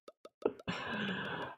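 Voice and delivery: soundless wheezy laugh, almost no voice; breathy silent laugh. Real, not acted. Streamer laughing at being taken for Mexican, breathy silent laugh. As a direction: laugh under your breath at the memory.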